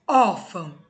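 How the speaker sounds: Órfão said loud with the stress on Ór. Shown as a demonstration. Teacher showing students the paroxytone stress, loud. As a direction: lean hard on the first syllable of Órfão. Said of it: "A penúltima é a mais forte".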